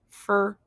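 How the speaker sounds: a questioning, isolated "Fur". Shown as a demonstration. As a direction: say Fur with an R-coloured vowel, "fer". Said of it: "say fur instead of for".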